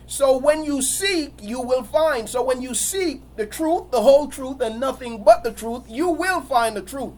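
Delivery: ordinary talk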